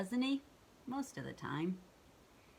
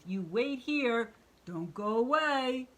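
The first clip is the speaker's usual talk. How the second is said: monster voice; 5 semitones higher, 5 dB louder than usual. Acted. Monster instructs tree.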